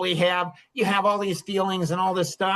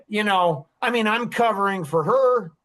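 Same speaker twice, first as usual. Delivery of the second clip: ordinary talk, nothing marked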